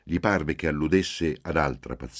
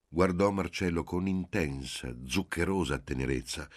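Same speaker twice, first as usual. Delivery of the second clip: ordinary delivery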